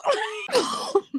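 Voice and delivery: high shrieking burst of laughter; helpless squealing laugh. Real, not acted. Laughing hard at the retold insult.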